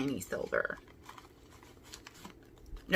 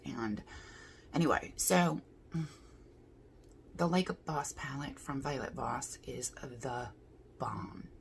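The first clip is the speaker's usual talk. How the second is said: usual speaking voice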